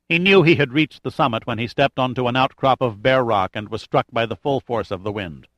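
usual speaking voice

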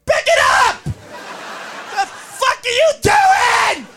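furious fan shout. Acted. Fan yelling at soccer players on TV, furious. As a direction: yell high and loud at the TV.

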